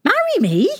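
high, shocked question. A narrator's reading. Princess hears suitors want to marry her, shocked. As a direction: squeak it upward in disbelief. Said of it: "she said, shocked".

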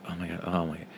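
an uneasy, hushed oh my God; hushed muttering. Retold or imitated. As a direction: mutter it nervously to yourself. Family uncomfortable in matching outfits.

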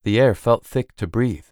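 plain reading voice

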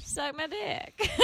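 slow, high mock southern accent through a laugh. Mocking the Florida mayor's crude offer, southern accent laughing. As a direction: finish the sleazy southern mayor bit while laughing. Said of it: "I don't know why I gave him a southern accent".

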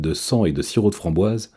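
plain reading voice

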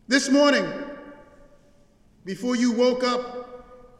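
ordinary talk, nothing marked

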